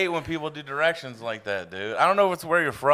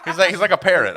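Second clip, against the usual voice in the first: ordinary delivery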